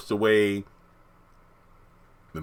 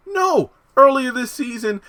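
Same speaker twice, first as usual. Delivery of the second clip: high-pitched, loud objection; exasperated high "no"; indignant no; 6 dB louder than usual. Real, not acted. Indignant rebuttal of the character's stance.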